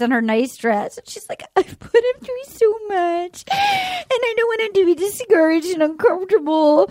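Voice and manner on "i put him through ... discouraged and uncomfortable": hammed-up crying voice; mock weeping. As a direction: whimper it like someone dabbing tears. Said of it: "she's wiping her eyes".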